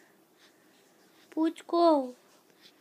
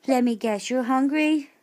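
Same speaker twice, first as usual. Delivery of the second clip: ordinary delivery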